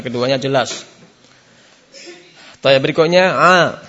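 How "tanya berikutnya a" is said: ordinary talk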